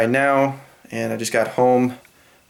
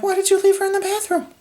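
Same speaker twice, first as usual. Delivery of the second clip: much higher, fast, disbelieving squeak; high rushed exasperated question; incredulous; faster than usual. Retold or imitated. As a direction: blurt it out in shocked confusion. Confused anger at stepdad, retold.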